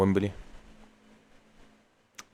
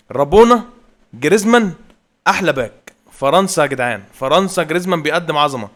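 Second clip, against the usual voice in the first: higher and louder hype; excited shouting; 5 semitones higher, 7 dB louder than usual. Real, not acted. Gamer hyping France after the goal, loud excitement. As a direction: keep yelling the praise.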